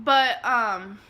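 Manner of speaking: ordinary talk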